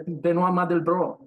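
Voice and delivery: "Benoit Mandelbrot" said the French way, ben-WA mahn-del-BRO; "Benoit" as ben-WA, "Mandelbrot" with a nasal a and no final t; French pronunciation. Real, not acted. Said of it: "You said it in French".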